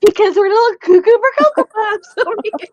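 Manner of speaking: high, loud, giddy laughter in the words; laughing. Real, not acted. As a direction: shout it while cracking up. Cracking up at own joke.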